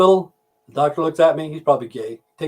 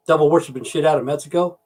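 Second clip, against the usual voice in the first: ordinary talk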